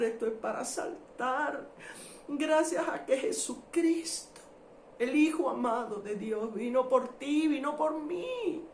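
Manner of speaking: emotional preaching on the edge of tears; moved, exultant thanksgiving; overcome praise. Real, not acted. Overcome, praising before congregation.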